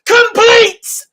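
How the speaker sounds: high-pitched, full-throated celebration yell; ecstatic shout. Real, not acted. Fan celebrating the comeback goal, ecstatic shouting. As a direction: keep screaming in sheer triumph.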